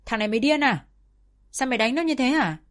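sharp angry outburst; scolding yell. A narrator's reading. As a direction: shout at her son to stop. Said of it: "hẩy bố ra và quát".

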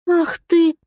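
indignant heroine snapping back; indignant outburst. A narrator's reading. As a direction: burst out in offended fury.

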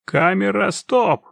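loud high shout to stop filming. Acted. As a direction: yell it across the set. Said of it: "Режиссёр громко кричит".

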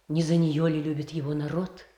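usual speaking voice

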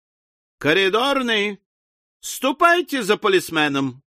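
calling out. A narrator's reading. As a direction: hail the porter, stretching the first word. Jingle summons the hotel porter.

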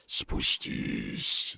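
breathy unvoiced creature mutter; a low rasp from the dark; muttering monster voice. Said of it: "настаивал голос".

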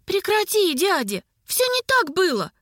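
put-on high-pitched girl crying out in protest; acted high, whiny outcry; wailing protest. A narrator's reading. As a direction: wail at your uncle to stop. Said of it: "заголосила из-за плеча герцога рыжеволосая девица".

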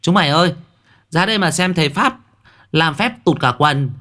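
put-on high, giggly child calling out to friends; a kid shouting to the others to come look; mocking child voice. Children mocking the shaman, calling in a high child voice.

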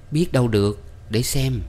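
plain reading voice

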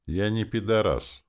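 measured, emphatic restraint; restrained. A narrator's reading. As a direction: deny it with held-in temper. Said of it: "сказал я сдержанно".

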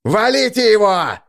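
loud, high yell to attack; shouted order. Acted. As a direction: bark the kill order.

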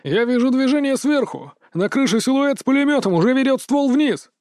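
high, urgent cry of alarm; shouted warning. A narrator's reading. Medic warns squad of a rooftop gunner, high urgent cry. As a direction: call out the danger at a high pitch. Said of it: "но вдруг крикнул".

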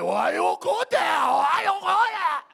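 high, loud, slow yelling mom; hammed-up mom yell. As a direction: yell it like a scandalized mother. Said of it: "my mom was like".

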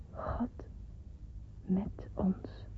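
hushed reverence. Acted. To herself on her sickbed, a hushed reverent whisper. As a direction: breathe the words as a revelation. Sick child's sudden religious realization.

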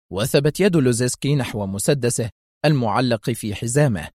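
plain reading voice

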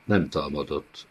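ordinary delivery, nothing marked

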